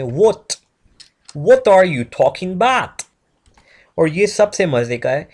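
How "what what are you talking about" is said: slow, exaggerated British accent; drawn-out British demo with a rounded "what"; English accent. Shown as a demonstration. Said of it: "word को बोलना है आपने".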